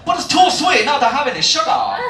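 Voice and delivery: mock disbelieving customer; mimicked customer. Imitates suspicious customer.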